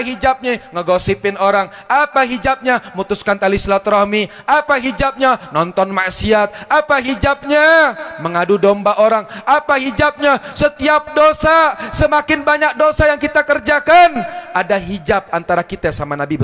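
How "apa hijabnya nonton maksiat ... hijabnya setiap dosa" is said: each question higher and louder; building preaching. Acted. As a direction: drive the question home harder each time. (tanya berulang makin menggebu)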